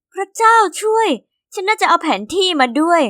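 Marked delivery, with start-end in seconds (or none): 0.1-1.1 a high, amazed gasp of wonder; amazed exclamation. A narrator's reading. Girl stunned by her family's new mansion, high and amazed. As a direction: gape at the huge mansion.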